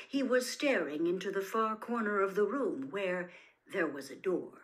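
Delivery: ordinary delivery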